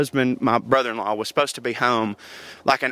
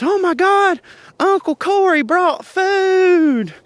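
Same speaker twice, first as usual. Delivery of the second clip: put-on very high, loud kid squeal, drawn out; hammed-up little-girl shriek of delight; imitating overjoyed child; 4 dB louder, much slower than usual. Niece announcing uncle brought food, imitated high excited shriek.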